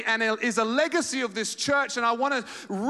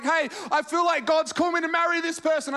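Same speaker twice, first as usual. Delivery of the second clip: put-on eager young believer; 8 semitones higher than usual. Preacher mimicking a hopeful believer at church, in a high eager voice. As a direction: play the naive convert sure of God's call.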